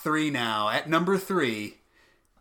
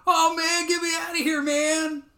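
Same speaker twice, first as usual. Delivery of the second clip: much higher, squeaky panicked cow voice; talking cow; 11 semitones higher than usual. Retold or imitated. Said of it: "and then the cow goes".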